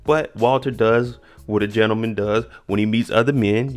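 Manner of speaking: ordinary talk, nothing marked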